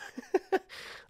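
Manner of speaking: high wheezy laugh; real giggle at own joke. Real, not acted.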